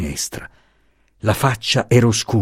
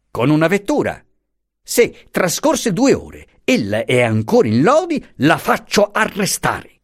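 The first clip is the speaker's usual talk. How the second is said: higher, scornful official; lightly voiced insolent commissioner; 8 semitones higher than usual. A narrator's reading. Commissioner scoffs at the professor's story, mildly scornful. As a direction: dismiss him with contempt.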